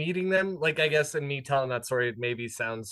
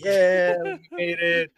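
mock cheer, drawn-out higher 'yeah'; crowd cheer; 5 semitones higher, 8 dB louder, slower than usual. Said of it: "everyone was just kind of like".

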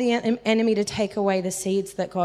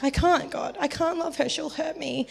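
higher, scared pleading; a hint of fear; panicked plea; 6 semitones higher than usual. Retelling her plea to God about the angry coworker, lightly scared. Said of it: "I panicked".

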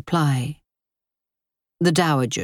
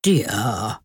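calm him down gently; gentle admonishing; 5 semitones lower than usual. A narrator's reading. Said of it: "admonished gently".

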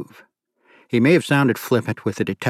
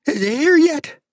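high, loud shrieking call from inside; woman screaming out from the house; 4 dB louder than usual. A narrator's reading. Hostage-taker screaming at police from inside, high and loud. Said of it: "They heard her scream".

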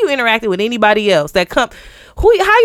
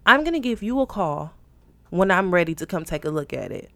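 soft, low, measured phone voice; controlled, professional softness; composed reply; 5 semitones lower, 6 dB quieter than usual. Retold or imitated. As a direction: end the call with quiet restraint. Retold composed answer to caller.

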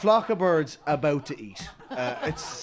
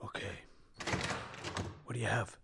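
whispering a secret password; 3 semitones lower, 11 dB quieter than usual. Acted. Secret deal, whispered exchange.

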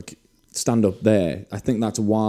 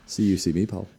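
put-on Scottish accent; 5 dB quieter than usual. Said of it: "best Scottish accent".

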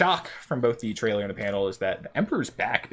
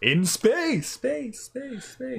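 echoing higher each time, fading away; echo effect; 13 semitones higher, much slower than usual. Pretend. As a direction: let space ring out and fade. Echo of the same words.

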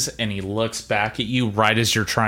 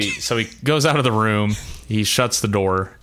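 ordinary talk, nothing marked

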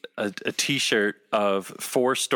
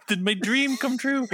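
excited; 12 semitones higher than usual. Retold or imitated. Retold excited thought.

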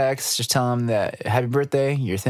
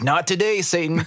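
retold yell; 7 semitones higher than usual. Co-host re-enacting the band's defiance of technical trouble, mock yell. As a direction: call it out high, as a playful rebuke. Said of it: "we kept on yelling out".

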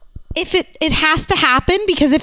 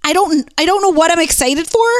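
ordinary delivery

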